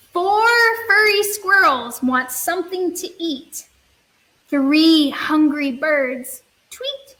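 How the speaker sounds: slow, sing-song storybook reading; slow children's story read-aloud. Acted. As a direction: read it gently to little kids.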